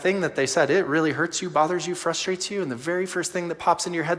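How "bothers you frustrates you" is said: ordinary delivery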